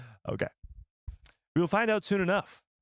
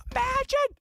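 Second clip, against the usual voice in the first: squealing exclamation; high excited shout. Acted. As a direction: shriek it with mock amazement. Exclaiming in mock amazement.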